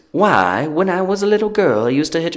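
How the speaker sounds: put-on high, sweet little-girl voice; girlish voice. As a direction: coo it like a charming young woman. Repeats the quote, higher.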